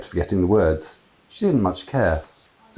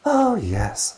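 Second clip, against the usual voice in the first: sighed; 12 semitones higher than usual. A narrator's reading. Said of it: "sighed Sally".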